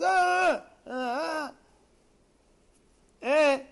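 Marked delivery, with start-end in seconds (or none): 0.0-1.5 high, loud yell that then drops off; mock screaming back in an argument; acted-out shouting-match scream; imitated scream. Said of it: "then the other guy screams".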